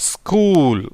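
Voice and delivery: ஸ்கூல் said as skool, s and k together, long oo; model pronunciation. Shown as a demonstration. Teacher shows students how to say ஸ்கூல், slow and clear. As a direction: say ஸ்கூல் carefully with a long oo vowel. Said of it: "எப்படி உச்சரிக்கப்படும் இது?".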